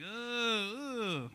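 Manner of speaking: high, drawn-out "uh"; imitated Carson noise. Demonstrating a late-night host's signature move, imitated long high groan. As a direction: mimic the host's rising-and-falling groan. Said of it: "where he's just like".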